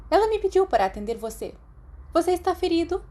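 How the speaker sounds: a strict witch teacher explaining; stern teacher voice. A narrator's reading. McGonagall voiced by narrator. (professora severa, voz mais aguda)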